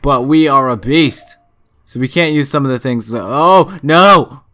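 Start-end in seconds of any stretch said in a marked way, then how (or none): 3.2-4.4 loud, higher-pitched cry of dismay. Real, not acted. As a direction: cry out as bad news hits. Dismay at losing favourite game weapon.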